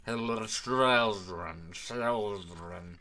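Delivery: words dragged and garbled, lurching in pitch; creepy distorted stutter; lagging, glitching voice. Acted. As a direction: loop the word like a broken recording. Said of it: "he's lagging super heavily and all of his frames are dropping".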